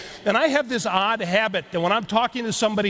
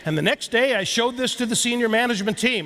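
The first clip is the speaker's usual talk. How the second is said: usual speaking voice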